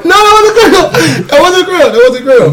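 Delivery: high-pitched laughing protest; laughing denial. Real, not acted. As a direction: laugh it out while denying. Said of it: "laughing denial".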